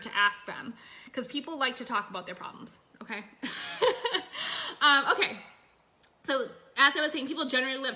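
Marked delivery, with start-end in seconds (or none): 3.3-4.8 airy giggle, pitched way up; high breathless laugh. Real, not acted. Coach laughing at herself on a live stream, breathy high giggle.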